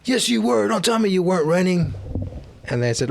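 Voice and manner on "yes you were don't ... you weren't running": high, louder mock scolding; mocking store clerk. Store clerk scolding the boy for running, high mock scold. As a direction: play the clerk snapping back at the kid. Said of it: "And then the guy's like".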